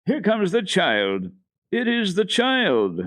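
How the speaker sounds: put-on high, slow voice of a joyful old woman; old grandmother calling out. A narrator's reading. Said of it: "the grandmother called out".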